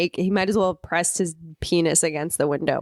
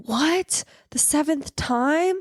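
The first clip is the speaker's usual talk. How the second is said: mock resigned boyfriend; 7 semitones higher, slower than usual. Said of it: "does this bit where he's like".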